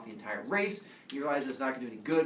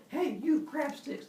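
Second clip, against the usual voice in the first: 1 high calling out; 12 semitones higher, slower than usual. Acted. Teacher voicing humans calling out.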